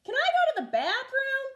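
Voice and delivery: put-on high little boy asking; eager kid voice. A narrator's reading. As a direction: pipe up like a young kid.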